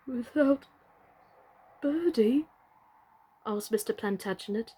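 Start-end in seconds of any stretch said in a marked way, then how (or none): 0.1-2.5 a small trembling question. A narrator's reading. Mister Plantagenet asking about Birdie, voice trembling. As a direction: ask it with a shaking voice. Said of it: "his voice trembling".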